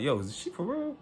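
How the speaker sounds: high-pitched disbelieving question, only mildly loud; retold loud protest. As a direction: retell an incredulous appeal to bystanders. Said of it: "I'm loud as fuck now".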